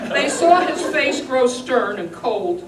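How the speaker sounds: ordinary delivery, nothing marked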